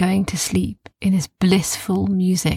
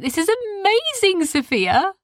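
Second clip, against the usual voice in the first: put-on high, thrilled boy's voice; boy amazed at Sophia's gift, high and excited; 12 semitones higher than usual. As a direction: gush with wonder. Kai amazed.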